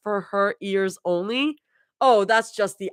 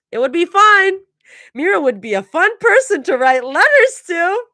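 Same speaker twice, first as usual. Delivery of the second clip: much higher and louder, bursting with glee; excited; 12 semitones higher, 9 dB louder than usual. A narrator's reading. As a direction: gush it out like an excited kid. Zoe's excited inner voice.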